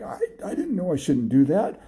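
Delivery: high, pleading little excuse; pleading boy. Acted. Boy to his scolding dog master, high and pleading. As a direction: voice the boy apologizing in a high pitch.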